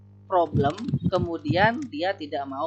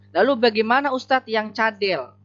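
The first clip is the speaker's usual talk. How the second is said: ordinary delivery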